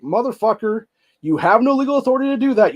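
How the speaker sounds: high-pitched, loud, indignant outburst; angry rant. Acted. Host berating Whitmer over the gun ban, high-pitched and angry. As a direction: berate them, pitch leaping right up. Rant addressed to absent official.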